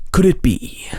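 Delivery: high breathy whisper, dramatic; ancient alien voice. Shown as a demonstration. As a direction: whisper it like a conspiracy narrator. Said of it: "let me try my ancient alien voice for this".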